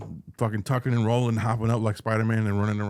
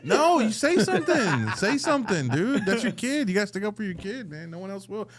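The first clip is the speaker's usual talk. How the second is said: heated rant; 8 semitones higher than usual. Real, not acted. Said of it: "ranting about dads".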